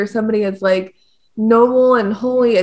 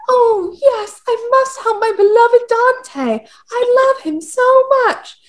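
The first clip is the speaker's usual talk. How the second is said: mock feminine courtly; 11 semitones higher than usual. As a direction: gush like a lovestruck lady of the court. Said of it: "very feminine, courtly".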